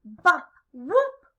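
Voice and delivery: lively picture-book read-aloud with acted sound words; reading to children. A narrator's reading. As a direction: read rhythmically, stretching key words and playing up the noises.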